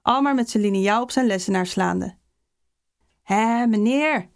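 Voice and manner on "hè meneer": slow, drawn-out schoolboy coaxing; wheedling. A narrator's reading. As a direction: stretch it out to wheedle the teacher. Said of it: "vleemden de jongens weer". (langgerekt, flemend)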